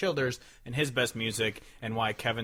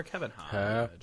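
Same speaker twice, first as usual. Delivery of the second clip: drawn-out intro; 3 semitones lower, 6 dB quieter, much slower than usual. Acted. Host introducing co-host by name.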